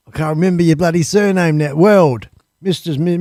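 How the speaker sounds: usual speaking voice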